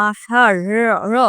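আষাঢ় ending on a light flapped র; flapped r demo. Shown as a demonstration. Teacher showing students how র sounds, slow and clear. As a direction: isolate র, র as a tongue flap. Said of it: "ঢয় বিন্দু র এর উচ্চারণটা এরকম".

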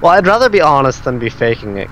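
normal talking voice

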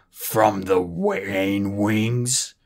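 looming dragon scorning the stolen egg; scathing. A narrator's reading. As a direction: let the scorn drip off each word. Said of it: "Moroseer interrupted scathingly".

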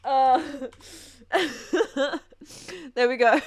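ordinary delivery

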